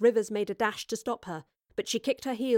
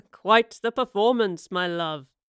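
slow, amused chuckle into the words; chuckle, then speak in slow mockery; chuckling; 5 dB louder, slower than usual. A narrator's reading. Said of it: "he chuckled".

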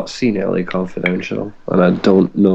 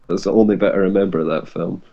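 ordinary talk

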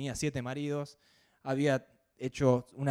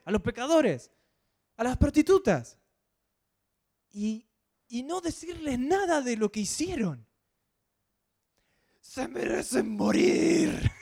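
scolding that builds to a high loud outrage; indignant disciples; 5 semitones higher, 5 dB louder than usual. Retold or imitated. Disciples scold Jesus, building to a high, loud, outraged shout. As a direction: grow angrier with every kind of sinner listed. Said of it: "le deberían decir los discípulos".

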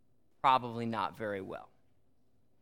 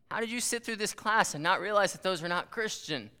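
higher-pitched mock disbelief; incredulous; 6 semitones higher than usual. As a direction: imitate a baffled teacher questioning a student. Retelling disbelief at a student.

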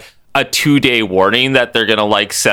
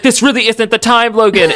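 high scolding voice; mock Storm; 10 semitones higher than usual. Storm scolding Logan, high mock scolding. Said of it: "Storm's like".

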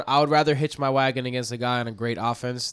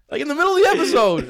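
laughing while talking; giggly, breaking up; 5 dB louder than usual. Real, not acted.

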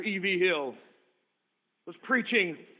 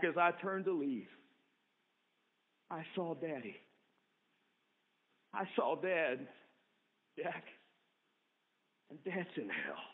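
very low, soft, near-whisper; hushed horror; 5 semitones lower, 13 dB quieter than usual. Acted. Sister telling her brother their father is in hell, hushed. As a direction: breathe the awful news.

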